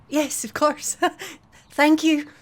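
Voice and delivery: high, breathless wonder at the colour; awed young woman. Acted. Sorsha gasps at the merchant's purple cloth, awed.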